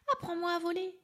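a little elephant pleading to learn. A narrator's reading. Little elephant asking the parrot, high eager voice.

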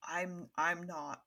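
a weary man who cannot face it; low broken Lupin. Acted. Lupin pushing the call back to Neville, low and halting. Lupin hesitating, GM voicing him.

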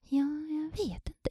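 slow, whispery, hesitant reply; imitating a timid, uncertain answer; unsure student. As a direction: drag it out in a small unvoiced voice.

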